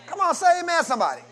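loud call for amen. Real, not acted. Preacher shouting to the church for an amen. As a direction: rouse the room to answer.

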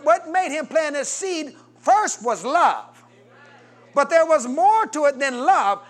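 high, loud climb to the peak of the sermon; full-force revival preaching; fervent preaching. Acted. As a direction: drive it home, building to the point.

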